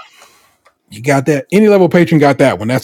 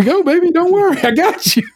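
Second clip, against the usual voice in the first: high, sweet reassurance to a pet; soothing the dog. Real, not acted. Owner comforting the dog before her insulin shot, sweet and high. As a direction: coo it to calm a nervous dog.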